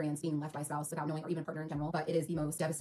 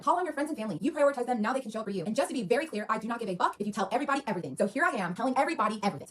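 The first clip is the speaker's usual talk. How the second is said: higher and louder, mocking the husband's text; spiteful text; 4 semitones higher, 5 dB louder than usual. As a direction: read the text like a spiteful jab, up high. Reads husband's spiteful text aloud.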